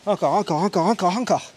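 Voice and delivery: urging. Real, not acted. Coach urging final reps.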